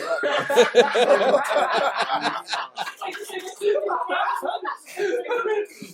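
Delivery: long laughing fit. Real, not acted.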